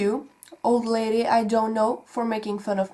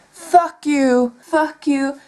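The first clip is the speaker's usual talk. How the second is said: louder, higher angry outburst; angry retort; 6 semitones higher, 6 dB louder, slower than usual. Real, not acted. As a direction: snap back at the troll. Angry reply to commenter.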